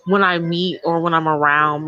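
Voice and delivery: normal talking voice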